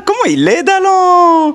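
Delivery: very high, loud excited Creole greeting; Réunion Creole. Retold or imitated. Speaker showing how he talked on Réunion, excited high Creole. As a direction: sing out the island greeting. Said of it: "So I start to speak".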